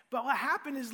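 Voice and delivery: ordinary talk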